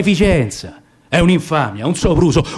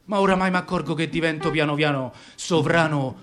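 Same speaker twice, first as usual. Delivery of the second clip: quiet bitter aside; 4 semitones lower, 6 dB quieter than usual. Acted.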